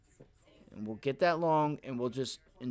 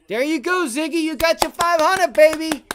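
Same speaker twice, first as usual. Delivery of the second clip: big high shouted cheer, much louder; excited cheer; 14 semitones higher, 14 dB louder than usual. Real, not acted. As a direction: yell it out celebrating a big win. Cheering a winning stock trade.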